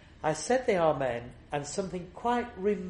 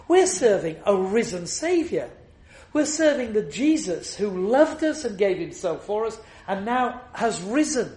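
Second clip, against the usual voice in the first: preaching at full force; 6 semitones higher, 7 dB louder than usual. Acted. Preacher to his congregation on the cross, thundering sermon. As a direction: proclaim it to the back of the church, hammering every refrain.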